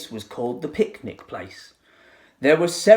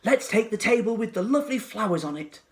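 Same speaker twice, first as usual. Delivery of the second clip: put-on child voice; 8 semitones higher, faster than usual. A narrator's reading. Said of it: "said another".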